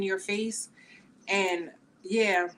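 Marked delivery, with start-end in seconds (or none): none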